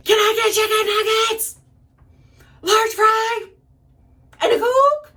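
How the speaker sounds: very high, squawked food order; unprofessional drive-thru yell. Retold or imitated. Drunk girl ordering McDonald's from the back seat, hollering. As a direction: drop all decorum and holler the order in a strained high pitch. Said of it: "all professionalism goes out the window".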